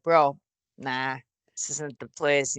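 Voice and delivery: low, soft, slow retold warning; low-key warning. Wrestler to a guy at the next booth, low quiet warning. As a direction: quietly warn him off, pitched low.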